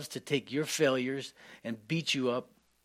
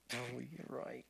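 soft, quiet, meek giving-in; meek admission; 12 dB quieter, slower than usual. Retold or imitated. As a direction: concede it softly and humbly. Quoting own reply to Spirit.

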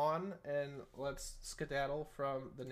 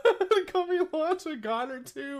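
high, loud laughter through the words; laughing; 10 semitones higher, 9 dB louder than usual. Real, not acted. Host reading out a game promo, breaking into laughter. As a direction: crack up while reading aloud. Cracks up during ad read.

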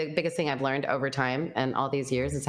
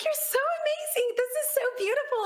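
very high, gushing wonder; overwhelmed adoration. Retold or imitated. Retelling her praise to God, very high and overwhelmed. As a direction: swoon over the beauty.

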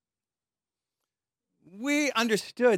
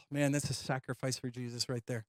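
wry sigh; 8 semitones lower, 13 dB quieter than usual. Real, not acted. Wry aside about fasting.

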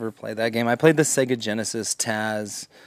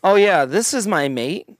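higher mock introduction of a pal; imitating dudes; 5 semitones higher, 4 dB louder than usual. Dude introducing his mate, higher mocking voice. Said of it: "when dudes are like".

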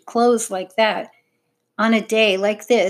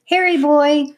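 calling out; 7 semitones higher, 6 dB louder than usual. A narrator's reading. Tom calls his dog.

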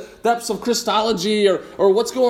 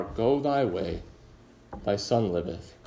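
low, soft and slow; gentle grace; 10 semitones lower, 7 dB quieter, slower than usual. Acted. Jesus gently telling the father his son lives, soft and tender. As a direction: say it tenderly, like a quiet blessing.

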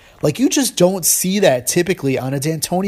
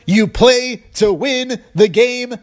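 high, slow, emphatic coach's catchphrase; mock impassioned coach quote, pitched way up; Herm Edwards; 9 semitones higher, slower than usual. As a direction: imitate a coach hammering out each word. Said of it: "coached by Herm".

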